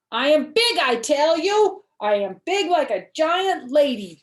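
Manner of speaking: put-on big-girl insistence, loud and high; little girl loudly insisting she's grown. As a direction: puff up and proclaim she is big.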